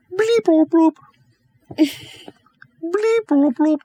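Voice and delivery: squeaky high computer chirps; high bleep bloop computer sounds. Acted. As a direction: chirp it like a cartoon console beeping. Ship computer hailing.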